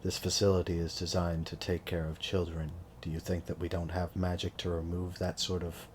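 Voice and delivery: low, quiet official reply; lightly voiced employee, soft and matter-of-fact; understated facility employee.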